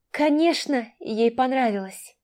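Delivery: ordinary delivery, nothing marked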